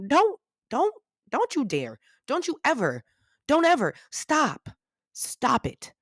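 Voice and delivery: high-pitched, sharply falling reprimands; hammed-up indignant telling-off; mock scolding. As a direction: playfully warn them off, word by word. Mock scolding of fake New Yorkers.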